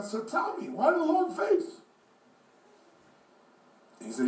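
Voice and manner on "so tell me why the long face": put-on high old man's voice, drawn out at the end; pitched-up old man questioning. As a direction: ask slowly in a high old-timer voice. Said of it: "this old man saw".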